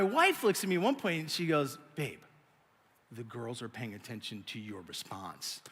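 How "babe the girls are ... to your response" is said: very low, very soft caution; hushed warning. Retold or imitated. Wife warning husband the girls are watching, hushed. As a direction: imitate a restrained spouse, nearly murmuring.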